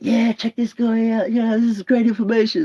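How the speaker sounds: high, impressed dude voice; impressed guest impression. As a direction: gush like an eager podcast guest. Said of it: "even this guy is sort of like".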